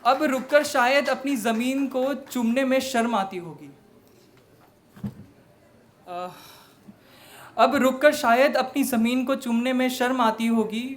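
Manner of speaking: high, loud declaimed verse; impassioned stage recitation; poetry recital. Acted. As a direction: project the lines out to the audience with full emphasis. Recites own poem.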